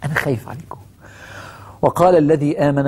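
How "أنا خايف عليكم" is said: soft, gentle worried plea; tender worry. Acted. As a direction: say it softly like a worried friend. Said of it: "حوار عاطفي".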